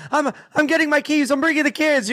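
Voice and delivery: high, rushed wife voice; frantic wife. Pretend. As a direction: panic as the wife grabbing keys. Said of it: "the woman's like".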